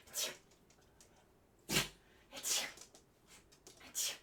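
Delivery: ordinary talk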